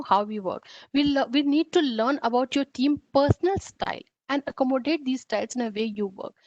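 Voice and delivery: normal talking voice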